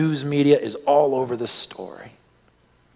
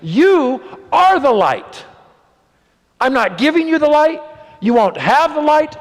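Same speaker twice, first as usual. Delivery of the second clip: thundering proclamation; 12 semitones higher, 5 dB louder than usual. Acted. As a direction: proclaim each word like a verdict. Quoting Jesus in preaching.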